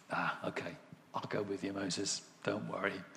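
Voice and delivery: low, soft reassurance; acted tender, hushed consent; gentle giving-in. God gives in tenderly to Moses.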